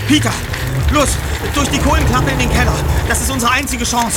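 high, loud urgent orders; urgent shouting. Acted. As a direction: shout to hurry. Urging escape through fire.

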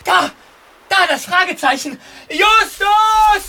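loud discovery, then calling out; excited shout. Acted. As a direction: shout with sudden hope. Spotting the sign, calling friend. (aufgeregt rufend)

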